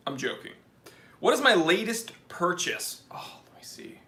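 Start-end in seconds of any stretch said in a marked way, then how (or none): 3.1-4.0 whisper. Real, not acted. Streamer checks chat aside.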